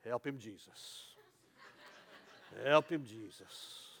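slow, low church lady plea; a lightly voiced old church lady, slow and soft; church lady murmur. Shown as a demonstration. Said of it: "And they go like this".

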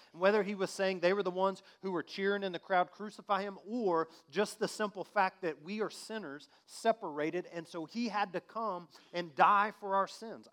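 loud, high sermon shout; impassioned preacher proclaiming; fiery preaching. Acted. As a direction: preach it out at full force. Raised preaching.

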